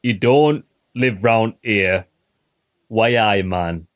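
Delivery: lightly mimicked Geordie mixed with Midlands; Geordie-Midlands accent. Player mimicking a tavern local's mixed accent, light Geordie-Midlands imitation. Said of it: "a Geordie mixed with a Midlands accent".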